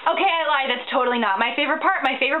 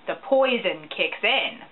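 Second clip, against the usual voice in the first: usual speaking voice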